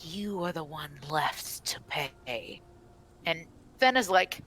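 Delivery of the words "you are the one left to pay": soft, whispered pronouncement; menacing forest spirit. Acted. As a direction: whisper a grave sentence, slowly at the end.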